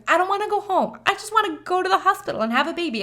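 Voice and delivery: put-on high, childlike whine; petulant determination; whiny insistence. Determined not sent home.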